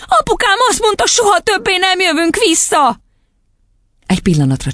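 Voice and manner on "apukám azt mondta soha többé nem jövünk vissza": put-on very high, upset little girl; squeaky distressed voice; child crying out. A narrator's reading. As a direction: wail the news childishly. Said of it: "kiáltotta Matilda".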